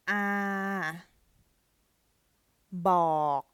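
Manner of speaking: clear, loud model reading of each Thai word; careful Thai demo. Shown as a demonstration. Teacher reading Thai words aloud for students, clear and loud. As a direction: read the Thai words out slowly for a learner. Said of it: "Please hit pause and read it yourself".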